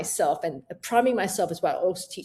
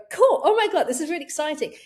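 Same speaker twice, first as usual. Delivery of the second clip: much higher, louder thrilled cry; excited; 7 semitones higher, 4 dB louder than usual. Retold or imitated. On chairlift after stop technique, thrilled high cry. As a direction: gush with thrill. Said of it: "I got that chairlift going".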